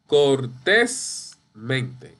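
Cortésmente in two slow chunks, TÉS stressed. Shown as a demonstration. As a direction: hold cor-TÉS, then let mente drop after a gap. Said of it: "Eso es sobre esdrújula".

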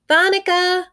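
put-on high, loud child's call; a small boy calling his babysitter; little boy yelling. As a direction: shout her name like an excited small boy. Little brother calls out loud.

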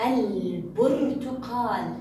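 "البرتقال" drawn out, the L clearly sounded; pronounced lam. Shown as a demonstration. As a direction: stretch البرتقال slowly, holding its ل before the ب. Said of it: "حرف الباء من حروف اللام القمرية".

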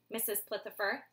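plain reading voice

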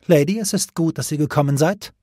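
imperious, lofty greeting; haughty. A narrator's reading. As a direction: address her down from the throne, coolly superior. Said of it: "sagte sie hochmütig".

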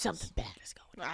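hushed, ominous whisper; paranoid whisper. Retold or imitated. Voicing their suspicions about the screaming room, paranoid whisper. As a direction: whisper the suspicion under your breath. Paranoid thoughts on shrooms, voiced.

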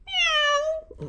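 a high squeaky meow; cat meow. Acted. George the dog meows at the vet, high meow. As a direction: meow like a kitten.

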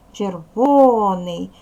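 "Червоний" said slowly and loudly, stress on "во"; syllable-stressed spelling. Shown as a demonstration. Teacher showing how the word is spelled, slow and stressed. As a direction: stretch out "Червоний", hitting the second syllable "во". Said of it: "дивіться, як пишеться".

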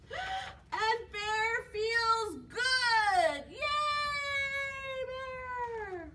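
high, slow, triumphant announcement; joyful cheer. A narrator's reading. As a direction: cheer for the recovered bear, stretching it out. Celebrating the bear's recovery.

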